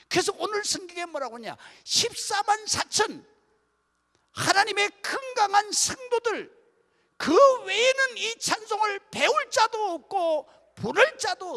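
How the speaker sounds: loud, high preaching to the congregation; full-force preaching. Acted. Pastor preaching loudly to the congregation, impassioned.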